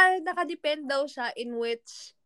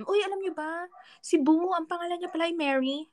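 higher-pitched trivia reveal; sharing trivia; 6 semitones higher than usual. Pretend. Telling those who don't know about Boo's name, pitched up. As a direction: let them in on a surprising fact, higher. Acts out telling others the fact.

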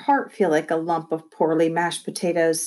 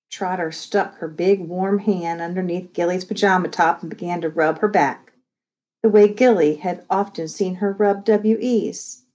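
ordinary delivery

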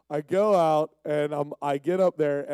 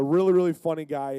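usual speaking voice

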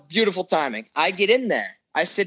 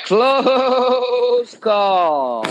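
big radio announcer, higher and louder; 6 semitones higher, 6 dB louder than usual. Acted. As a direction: boom out the segment title like a show host. Announcing the segment title.

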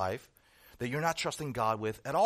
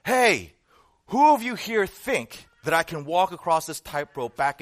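loud showman's challenge, easing off after the first word; retold yell; 3 semitones higher, 7 dB louder than usual. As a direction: call out to a gathering crowd. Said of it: "he yelled out to them".

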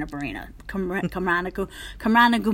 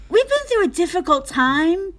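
higher, halting stage address; Kristen's botched speech; 7 semitones higher than usual. Retold or imitated. Imitating castmate stuck mid-speech onstage, halting delivery. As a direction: lift it up and leave it hanging. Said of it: "what she does instead is goes".

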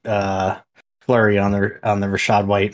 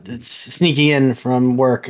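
ordinary talk

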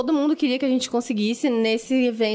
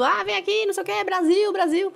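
calling out; 7 semitones higher than usual. Retold or imitated. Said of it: "a gente ficava meio gritando".

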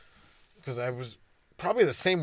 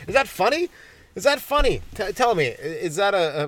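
mock indignation; 6 semitones higher, 5 dB louder than usual. Mock scolding the audience.

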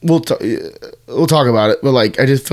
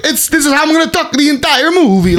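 high-pitched mock Italian accent; hammed-up Italian cartoon dude; fake Italian accent; 12 semitones higher than usual. Mocking Mario talking so all movie, fake Italian accent. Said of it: "fake ass Italian dude".

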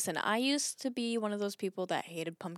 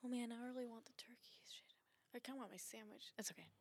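whispering; 22 dB quieter, much faster than usual. Real, not acted. Muttering to self about food.

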